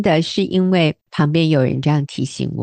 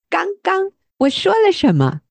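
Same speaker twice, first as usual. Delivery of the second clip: much higher, disbelieving questions; incredulous; 13 semitones higher than usual. Retold or imitated. To her husband, denying she was harsh, incredulous. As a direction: sound truly baffled. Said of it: "我听了吓一跳".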